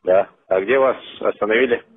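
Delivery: ordinary talk, nothing marked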